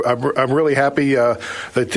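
usual speaking voice

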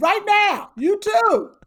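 high, loud command; retold order. Telling how she stopped the pair on the carpet, retold loud order. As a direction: call them out insistently, retelling it.

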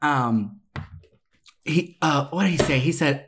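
ordinary talk

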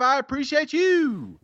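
showy sign-off to a reviewer; catchphrase flourish. Real, not acted. Same catchphrase to next reviewer.

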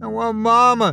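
put-on high, loud, sobbing child's cry. A narrator's reading. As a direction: wail it through tears. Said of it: "he cried".

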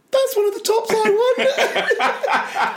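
mock teenage brother. Brother telling Mum, mock high laughing voice. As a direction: squeak it like a shy kid. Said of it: "you said to Mum, like".

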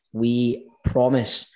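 We promise drawn out slowly, each word weighted; slow emphatic promise. Real, not acted. Host repeating to listeners, slow earnest promise. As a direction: stretch out We promise to mean it.

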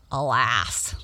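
low, put-on frog voice; one deep, regretful word; lamenting frog. Frog laments salty pond.

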